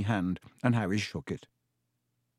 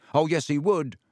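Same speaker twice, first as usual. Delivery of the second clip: raised, mildly loud retort; 4 semitones higher, 3 dB louder than usual. A narrator's reading. Ron backing Dean against Lockhart, loud. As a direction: cut in with a loud objection. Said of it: "even more loudly than Dean".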